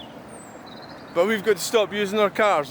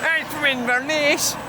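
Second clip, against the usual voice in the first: slow, high Scottish Highland lilt, hammed up; put-on Inverness accent, stretched out and high; 9 semitones higher, slower than usual. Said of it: "I'll do my Inverness accent".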